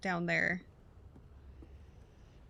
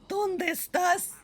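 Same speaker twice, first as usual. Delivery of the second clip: creepy villager voice; 10 semitones higher, 4 dB louder, much slower than usual. Retold or imitated. Echoing the Spanish-speaking villagers.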